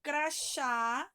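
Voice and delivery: Crachá with a loud, held chá; stress on last syllable. Shown as a demonstration. Teacher helping pupils find the strong syllable, Crachá stressed on chá. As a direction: punch the chá at the end of Crachá. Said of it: "sublinha o chá".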